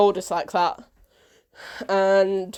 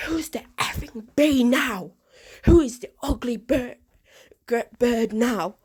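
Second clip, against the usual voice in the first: higher, mock screaming taunt; screaming girl; 3 semitones higher than usual. Girl attacking the oldest sister, taunting, screamed higher. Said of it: "who was screaming at her".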